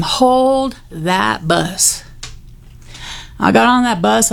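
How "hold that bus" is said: normal talking voice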